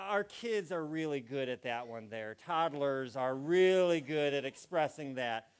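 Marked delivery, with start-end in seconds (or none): none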